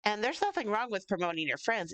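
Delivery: ordinary delivery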